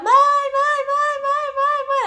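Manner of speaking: high, loud calls for mom; desperate yelling. Retold or imitated. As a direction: scream for mom, trapped and panicking. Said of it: "começou a gritar, desesperada".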